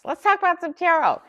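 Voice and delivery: light up as the fun topic starts; excited sing-song. Real, not acted. Host cheerfully introducing the tarot topic, excited sing-song.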